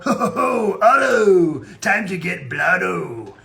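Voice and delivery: laughing, shouted stoner cartoon voice, slow at the end; Otto voice. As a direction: whoop his own name, then drag out the last line. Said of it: "I used to love doing Otto".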